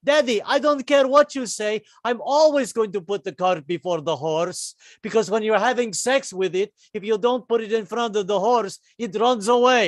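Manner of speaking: put-on petulant young boy defying his father; defiant child. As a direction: sass Daddy right back.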